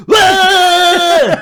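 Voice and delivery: high, loud startled shriek; startled scream. Retold or imitated. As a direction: shriek in sudden fright as a face appears. Mum frightened on dark stairs.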